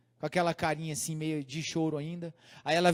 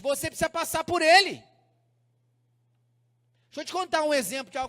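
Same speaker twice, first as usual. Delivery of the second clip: raised, emphatic sermon delivery; preaching; 6 semitones higher, 6 dB louder than usual. Real, not acted. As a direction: preach it out to the congregation with force. Preaches at full force.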